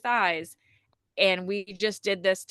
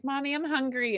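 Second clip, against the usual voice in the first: put-on child voice; 7 semitones higher, 3 dB quieter than usual. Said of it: "then she's like".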